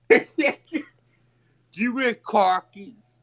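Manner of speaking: high, slow question through laughter; laughing joke. Real, not acted. Playing along with the khakis joke, laughing.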